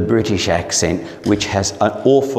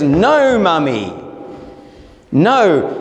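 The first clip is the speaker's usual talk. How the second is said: put-on higher, emphatic little-boy protest; small son correcting Mum; 5 semitones higher, slower than usual. Three-year-old to his singing mother, firm high correction. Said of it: "and he says".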